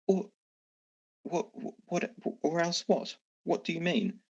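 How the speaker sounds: a bluff that's starting to crack; mayor challenging. Acted. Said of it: "and says".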